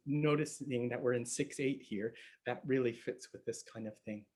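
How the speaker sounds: ordinary talk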